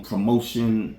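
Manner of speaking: normal talking voice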